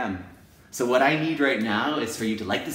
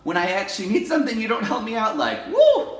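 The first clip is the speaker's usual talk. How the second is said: rising frustration; 11 semitones higher, 6 dB louder than usual. Retold or imitated. Resentment building to eruption.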